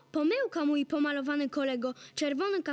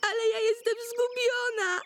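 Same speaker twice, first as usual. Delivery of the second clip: high, bawled protest; tearful whining; 6 semitones higher than usual. Acted. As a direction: wail it back like a lost girl. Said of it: "Nie becz tak głośno".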